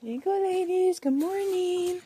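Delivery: high, sing-song call to the hens. Real, not acted. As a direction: coax the hens over in a high voice. Calling the chickens over.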